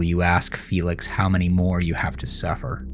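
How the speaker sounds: ordinary delivery, nothing marked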